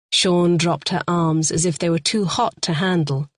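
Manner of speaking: usual speaking voice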